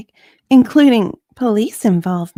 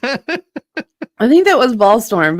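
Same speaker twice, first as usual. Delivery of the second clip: slow, giggly reply; laughing; slower than usual. Real, not acted. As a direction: answer while still chuckling. Witness laughing along.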